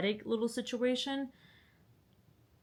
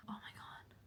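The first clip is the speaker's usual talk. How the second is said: barely voiced whisper; hushed dread; 19 dB quieter than usual. Real, not acted. Vlogger seeing lightning return, hushed whisper. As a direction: whisper it to yourself.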